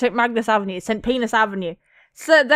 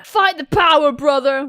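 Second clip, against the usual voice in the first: raised, loud rallying cry; yelled encouragement; 3 semitones higher, 5 dB louder than usual. Acted. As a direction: cheer on a fellow rider. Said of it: "one yells".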